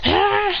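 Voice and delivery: high-pitched acted yell; transforming cry. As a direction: cry out as power rises. Transforming in a fight.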